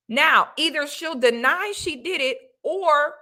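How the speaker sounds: ordinary delivery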